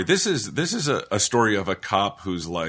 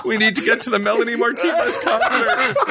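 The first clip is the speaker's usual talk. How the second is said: high, hammed-up wail through laughter; badly faked tearful child pleading; mock crying kids; 12 semitones higher than usual. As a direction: wail like a kid, half laughing. Playing the crying kids to police.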